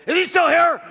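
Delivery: high, very loud imitated bellow; hard-nosed father barging in. Father poking his head back in, acted angry shout. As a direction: play the tough dad yelling through the door. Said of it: "hard-nosed character".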